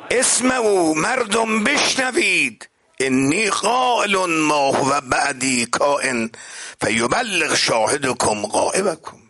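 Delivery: proclaiming. Retold or imitated. Preacher as the Prophet telling people to listen, high slow proclamation. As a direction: imitate a prophet addressing a great crowd.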